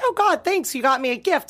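high-pitched gushing thanks; imitating grateful husband. Wife mimics husband opening his gift, gushing. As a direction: put on his delighted surprise.

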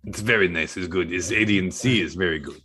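lightly put-on Croatian accent in broken English. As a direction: play a Croatian hitman, mildly accented. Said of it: "This is Croatian accent".

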